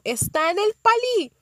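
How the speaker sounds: a woman's voice, pitched high; woman caller. Retold or imitated. Unknown woman on phone.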